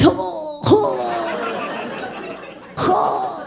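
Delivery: exaggerated heaving noises; mock cat retching. Guy puking like a cat making a scene, mock retching. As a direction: heave like a cat coughing up a hairball.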